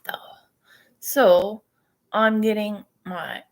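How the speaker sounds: ordinary talk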